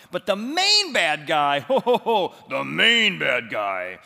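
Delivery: "main" stretched long and loud, with a laugh; stress on main. Acted. Preacher teasing the congregation about the villain, hammed-up stress on main. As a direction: lean hard on "main bad guy" and laugh through it.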